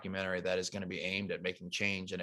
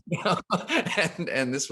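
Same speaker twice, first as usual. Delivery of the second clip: laughing; 8 semitones higher than usual. Real, not acted. Laughs at his own story.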